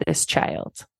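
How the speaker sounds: whispered inner voice. Retold or imitated. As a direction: drop to a bare whisper. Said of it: "it was".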